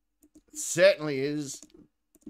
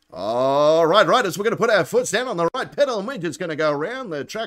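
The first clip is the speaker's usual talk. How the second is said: dodgem car announcer. Shown as a demonstration. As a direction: rattle it off like the bloke calling the dodgem cars. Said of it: "one bloke that goes".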